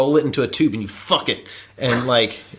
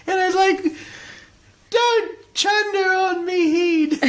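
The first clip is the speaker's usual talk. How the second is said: slow, very high mock-British protest; hammed-up British falsetto; British accent. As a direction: draw it out in a squeaky British caricature. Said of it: "should we do it in a British accent?".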